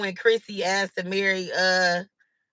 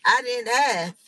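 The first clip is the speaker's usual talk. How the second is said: laughing; 3 semitones higher, slower than usual. Real, not acted. Repeats her line, slower, laughing.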